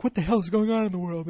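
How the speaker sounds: high, loud mock panic; a hammed-up panicked wife; freaked-out cry. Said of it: "she's freaking".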